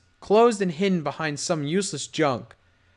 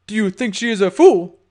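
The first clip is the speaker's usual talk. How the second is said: angry; 6 semitones higher, 8 dB louder than usual. Acted. Gangster threatens.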